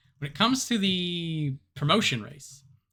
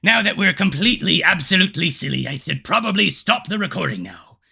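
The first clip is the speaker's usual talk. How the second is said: loud pirate sign-off; 3 semitones higher, 8 dB louder than usual. Acted. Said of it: "pirate voice".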